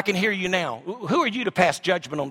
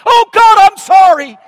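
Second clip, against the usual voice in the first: a much higher, much louder pretend cry of remorse; mock plea for mercy; 13 semitones higher, 13 dB louder, slower than usual. Pastor voicing the repentant cry Madoff never made, hammed-up wail. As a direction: wail it like the sinner begging God.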